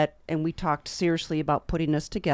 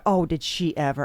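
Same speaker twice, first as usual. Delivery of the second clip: usual speaking voice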